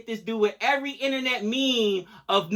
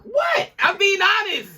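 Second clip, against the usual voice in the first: indignant high-pitched what; high-pitched protest; 11 semitones higher, slower than usual. Real, not acted. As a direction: voice shoots way up high. Defends himself as partner laughs.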